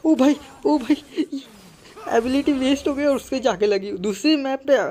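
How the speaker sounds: real gamer outrage, pitched high; exasperated outcry. Real, not acted. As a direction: wail it out like the shot just went to waste. Special ability wasted, frustrated gamer.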